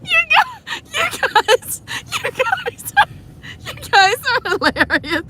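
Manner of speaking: high laugh-talk; real laughter between words; laughing hard. Real, not acted. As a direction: voice squeaking through laughter.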